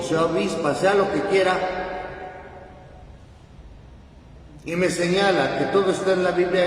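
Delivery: loud, high, driving sermon voice; fervent preaching. Acted. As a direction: preach it at full force to the congregation. Priest preaching with rising force.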